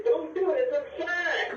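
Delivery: very high, slow drawn-out plea; mock pleading. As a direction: beg her to leave her hair alone. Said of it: "grab your hand and say".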